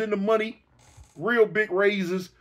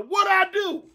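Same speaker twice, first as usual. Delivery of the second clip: mock holler; 9 semitones higher than usual. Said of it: "turn around and holler".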